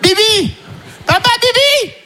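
put-on toddler screaming. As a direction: scream it over and over like a baby wanting a bottle. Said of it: "il veut son biberon".

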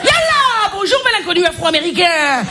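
hammed-up North African accent from Constantine. Porn film greeting at the door, broad Constantine accent. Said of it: "avec l'accent de Constantine".

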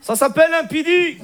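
high mock Moroccan accent; blunt retort in a hammed-up Moroccan accent. Cousin retorts to her online, Moroccan accent. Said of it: "l'accent arabe".

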